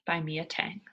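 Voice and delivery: ordinary delivery, nothing marked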